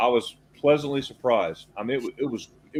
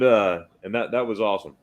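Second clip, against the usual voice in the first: ordinary talk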